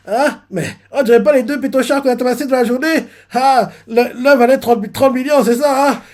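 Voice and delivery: lightly voiced giddy drunk; excited drunk man. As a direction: read the bounties like a tipsy sailor. Drunk man reading bounties.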